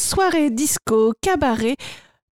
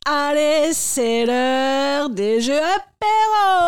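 cruise entertainer; 3 semitones higher, slower than usual. Acted. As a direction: whip up the crowd like a cruise animator. Cruise animator hosting games.

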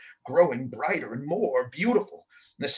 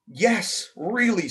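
insisting; 6 semitones higher, 3 dB louder, much slower than usual. Acted. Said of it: "Samantha insisted".

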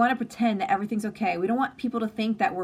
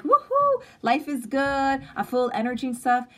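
hammed-up upbeat persona; mock energetic; 5 semitones higher, 3 dB louder than usual. Imitating her usual upbeat live-stream self, hammed-up. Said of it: "As much as I come on here like".

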